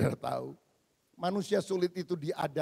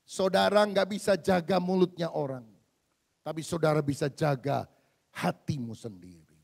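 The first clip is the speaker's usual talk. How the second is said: emphatic preaching; 2 semitones lower, 8 dB louder than usual. Real, not acted. Preacher urging congregation.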